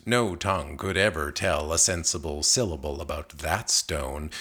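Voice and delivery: usual speaking voice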